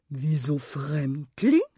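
slow, drawn-out affronted tomcat. A narrator's reading. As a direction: question the insult grandly. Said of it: "rief der Kater". (gedehnte, gekränkte Katerstimme)

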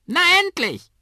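schrilles, sehr hohes Papageienkrächzen; krächz es wie ein Vogel; krächzender Kakadu. A narrator's reading. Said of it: "krächzt Oskar". (Kakadu krächzt laut und hoch)

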